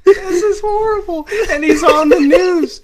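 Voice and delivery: laughing high and loud. Real, not acted. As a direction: crack up while talking. Reacting to funny name.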